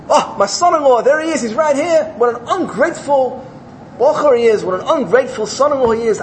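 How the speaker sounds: the wronged farmer airing his grievance; indignant outburst. Acted. Farmer to the Rosh Hashiva, high indignant complaint.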